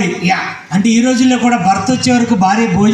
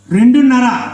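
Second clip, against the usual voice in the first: raised questioning repeat; incredulous echo; 3 semitones higher than usual. Acted. Ventriloquist questioning the puppet's child count, incredulous. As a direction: question it with mild disbelief.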